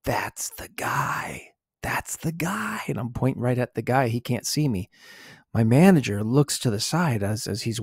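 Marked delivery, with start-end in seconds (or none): 0.0-2.8 hushed, drawn-out whisper; word it silently across a room, barely voiced; slow, soft stage whisper; mouthed whisper. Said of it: "I just word to him".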